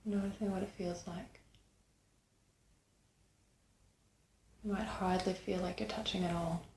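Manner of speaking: unhurried meditation guide, long pauses between phrases; guided meditation. Acted. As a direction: guide them gently inward, leaving space after each phrase.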